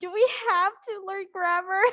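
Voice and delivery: high-pitched mock student whine; playful put-on grumbling; mock bored complaint. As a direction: imitate a bored student pleading to be let off. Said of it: "Grady is already bored".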